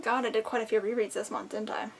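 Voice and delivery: normal talking voice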